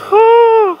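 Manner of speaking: high, loud whoop; excited whoop. Real, not acted. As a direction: whoop at the view. Cheering at the view.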